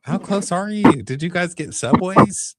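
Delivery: high, puzzled questioning; put-on incredulous query; mock bafflement. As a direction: imitate someone confused by a sudden arrival. Voicing puzzled reply to Allison.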